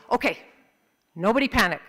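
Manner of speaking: loud, firm order in short bursts; commanding dad. Acted. Dad to family after the crash, loudly taking charge. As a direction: take charge, pausing after the first word.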